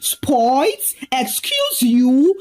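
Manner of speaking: drawn-out, rising offended question; dramatic indignation. A narrator's reading. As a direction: repeat it slowly, scandalised. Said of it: "Liz gasped dramatically".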